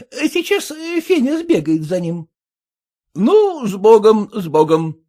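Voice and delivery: заметно выше, добродушная старушка; тётушка хлопочет и благословляет; голос тётки. Acted. Тётка о учителе. (высокий тёткин голос)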